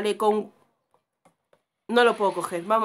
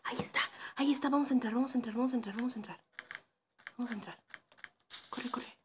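rapid whisper, getting softer; urgent hushed whisper; 7 dB quieter, faster than usual. Real, not acted. Player sneaks into the house, urgent whisper. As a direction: urge yourself in under your breath.

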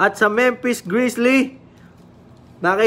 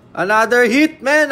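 ordinary talk, nothing marked

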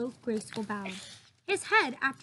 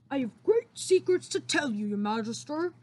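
a hint of the giant's voice; lightly voiced giant; 3 dB louder than usual. The BFG telling the Queen of secrets, lightly voiced giant. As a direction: confide like a gentle giant.

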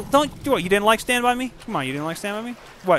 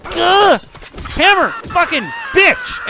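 frustrated yell; 12 semitones higher, 4 dB louder, much slower than usual. Real, not acted. Frustrated by enemy.

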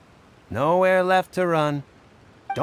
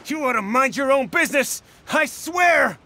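angry threat; 5 semitones higher than usual. Acted. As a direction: warn her off, rising into a yell. Thug snaps at woman.

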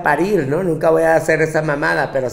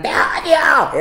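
mock teen outburst; 11 semitones higher, 4 dB louder, slower than usual. Teens shouting at mothers.

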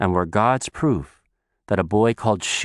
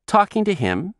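put-on little girl voice; 11 semitones higher than usual. Child voice.

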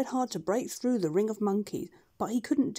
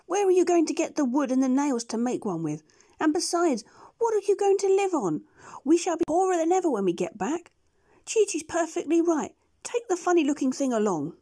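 higher, louder duck voice; scolding duck; 8 semitones higher, 5 dB louder than usual. Acted. Dab Dab scolds the doctor about money, high scolding duck. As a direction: scold like a sharp housekeeping duck. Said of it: "said Dab Dab".

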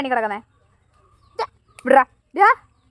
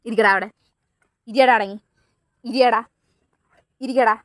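firm command; 6 semitones lower than usual. Real, not acted. Ordering dog to sit.

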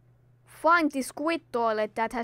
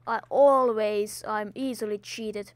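ordinary talk, nothing marked

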